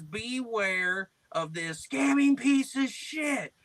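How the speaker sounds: high, slow, drawn-out prank voice; mock screen reading.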